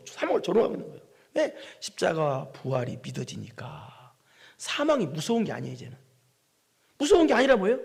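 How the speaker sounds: normal talking voice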